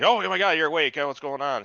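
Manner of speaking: a hint of startled Elrond; mock alarm. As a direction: gasp it out like a startled elf. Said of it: "Elrond's like".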